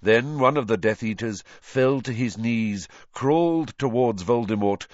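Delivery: plain reading voice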